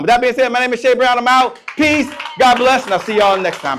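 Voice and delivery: high, loud farewell to the crowd; big finish, punch out each goodbye; rousing sign-off. Real, not acted. Speaker's big sign-off to audience.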